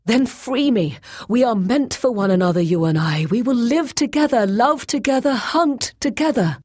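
high, loud, impassioned urging; fervent plea. A narrator's reading. Boyce urging Grey Alis to free and join him, fervent plea. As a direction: plead ardently for a life together. Captive fervently pleads to be freed.

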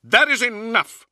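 high, forceful cut-off shout; angry shout. A narrator's reading. Librarian cutting off Luther's slander, shouting. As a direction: silence him with a loud, outraged cry. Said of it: "Brother Librarian Israfel shouted".

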